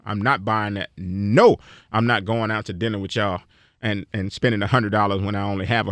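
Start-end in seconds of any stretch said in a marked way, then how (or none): 1.0-1.6 no, louder and with passion; passionate no. Shown as a demonstration. Refusing dinner spending, a passionate louder no. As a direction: say no like you mean it. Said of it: "Say it with some passion".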